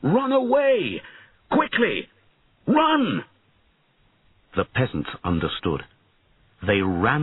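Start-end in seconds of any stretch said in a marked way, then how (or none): 0.0-3.2 high, drawn-out warning cry; urgent high-pitched shout; urgent calling out. A narrator's reading. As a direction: call to a crowd to flee. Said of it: "Bowen called to the peasants".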